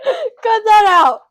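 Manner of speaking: loud, slow laughing plea. Real, not acted. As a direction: laugh it out, begging. Laughing, begging to cut.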